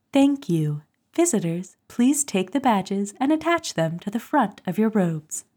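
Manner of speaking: a lightly voiced cool announcer read; cool female voice reading. Shown as a demonstration. Host asked for the reading voice, lightly cool announcer. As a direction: read it like the smooth ministry voice. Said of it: "let me give you a snip".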